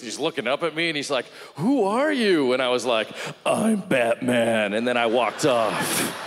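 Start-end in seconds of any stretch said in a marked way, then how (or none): 1.6-2.5 high, loud, confused cry; acted stunned bewilderment, pitched way up; bewildered. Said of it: "He's just bewildered and confused". 3.5-4.7 loud, very slow hero growl; mock-heroic vigilante voice; Batman. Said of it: "I wanted to be Batman".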